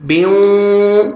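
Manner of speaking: بیوں with the sound carried into the nose; nasalized idgham. Shown as a demonstration. Teacher demonstrating ghunna on بیوں. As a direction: merge the tanween into waw through the nose. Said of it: "نوز میں sound لے کے جائیں گے".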